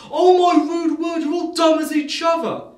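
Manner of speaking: high, loud, slow furious mother; hammed-up yelling entitled mum; mock shout. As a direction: shriek the insult at the staff. Mother insults staff.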